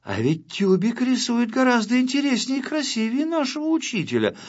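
high-pitched pupils' voice; young pupils' thought. A narrator's reading. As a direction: muse in a high, youthful voice. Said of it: "соображали начинающие художники". (высоким голосом учеников)

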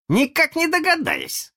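put-on very high child voice; squeaky playful boy; boy's voice. A narrator's reading. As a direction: pipe up like a little boy. Boy joins the guessing game.

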